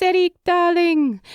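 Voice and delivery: high affectionate darling; fond mother voice. A narrator's reading. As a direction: coax him sweetly. Micah says name.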